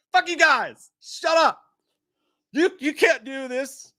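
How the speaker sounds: high-pitched indignant whine at teasing; mock outrage. Host protesting to the chat that corrected him, mock outrage.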